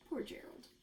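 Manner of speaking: breathy whisper of pity. Real, not acted. Teacher whispering pity for Gerald to the class. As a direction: whisper it like a sorry secret.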